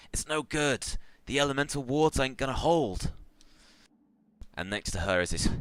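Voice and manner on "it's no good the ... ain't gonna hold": high-pitched, alarmed orc shaman; urgent yell. Acted. Orc shaman warns that the wards are failing, urgent high yell. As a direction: call out a warning, voice pitched well up. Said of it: "and she yells out".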